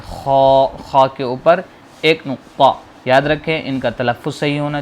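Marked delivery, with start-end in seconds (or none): 0.1-0.7 خا scraped from the throat; guttural خا. Shown as a demonstration. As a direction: rasp خا out of the throat. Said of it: "اس کو حلق سے نکالیں گے".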